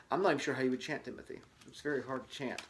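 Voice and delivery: plain reading voice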